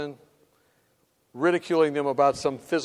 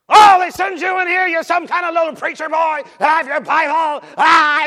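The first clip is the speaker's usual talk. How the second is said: high, loud, raging mockery; yelling, screaming; 10 semitones higher, 10 dB louder than usual. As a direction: imitate an enraged patient fulminating at a preacher. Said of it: "he's yelling, screaming".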